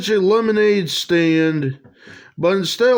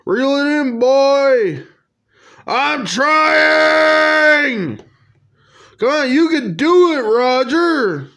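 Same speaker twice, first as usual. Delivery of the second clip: higher, eager encouragement; excited cheering; 6 semitones higher, slower than usual. Acted. Friend urging Roger to reel in the catch, excited. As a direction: urge him to reel it in.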